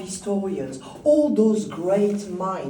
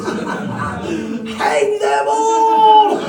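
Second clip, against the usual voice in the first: drawn-out laughing roar, much higher and louder; mock judge's shout; 13 dB louder, much slower than usual. Guide playing a claret-soaked judge, laughing hammed-up shout. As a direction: bellow the sentence like a drunk judge.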